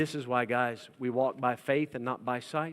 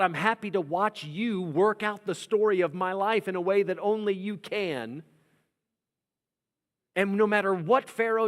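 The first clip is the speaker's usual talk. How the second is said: prayer spoken with rising intensity; fervent prayer; 5 semitones higher than usual. Acted. Preacher praying aloud to God, building fervour. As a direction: pray it like a preacher before his flock.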